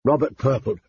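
ordinary delivery, nothing marked

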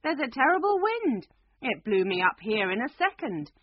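loud urgent call, starting high. A narrator's reading. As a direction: call the warning down from above.

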